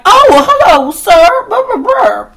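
high, loud prim greeting; Dorothy impression. Imitating Dorothy greeting the traveling magician, high and loud. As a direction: chirp it like an old-film ingénue. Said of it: "she's like".

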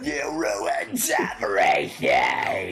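slow, growled screaming rant; death metal shout. Shown as a demonstration. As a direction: roar it like a metal vocalist. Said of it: "channel so much death metal".

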